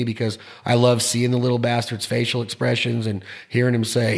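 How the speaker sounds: ordinary delivery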